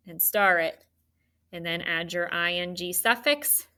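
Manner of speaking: normal talking voice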